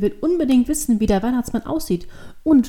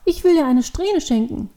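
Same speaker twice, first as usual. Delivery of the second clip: put-on higher-pitched little girl voice; determined child; 6 semitones higher, 4 dB louder than usual. A narrator's reading. Devil girl offers a lock of hair, determined child. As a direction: declare it like an eager child. Girl insists on her gift.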